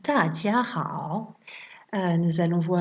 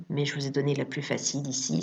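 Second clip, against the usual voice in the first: normal talking voice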